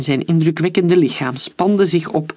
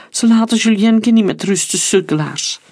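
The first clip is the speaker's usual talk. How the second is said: higher-pitched lament, falling away at the end; wailing; 6 semitones higher than usual. A narrator's reading. Pale woman lamenting the teasing of Julien, high wail easing down. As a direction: lament pityingly, starting high and dropping. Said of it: "jammerde een bleke vrouw".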